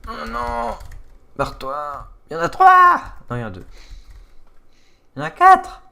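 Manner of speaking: high panicked yelling at mobs; panicking gamer. Real, not acted. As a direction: yell in rising panic. Mobs attacking in game.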